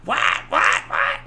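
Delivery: high screech over the phone; mock screaming. Schwartz's mom screams into the phone, mock screech. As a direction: imitate a shrieking mother down the line. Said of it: "she's screaming so loud".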